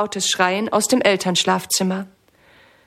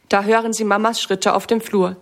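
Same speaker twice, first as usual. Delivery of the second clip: ordinary delivery, nothing marked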